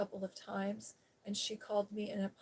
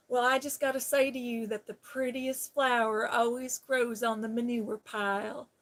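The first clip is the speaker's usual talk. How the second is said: louder impression of a flirty officer, slowing at the end; folksy compliment in an imitated officer's voice; imitating sweet sheriff's officer; 2 semitones higher, 6 dB louder than usual. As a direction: play the sweet-talking officer, projecting, then draw out the last words. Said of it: "he talks like this, he says".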